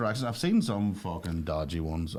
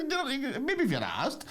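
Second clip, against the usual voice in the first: high mock reply; slower than usual. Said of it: "I was like".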